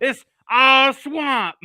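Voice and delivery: high hammed-up cartoon voice; movie quote voice. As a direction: drag it out in a put-on voice. Quoting the movie line.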